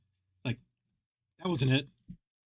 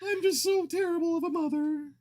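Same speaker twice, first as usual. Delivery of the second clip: much higher, whiny self-pitying mother; mock a mother wailing about being terrible; hammed-up tearful mom voice; imitating mom. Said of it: "you're about as bad as my mom".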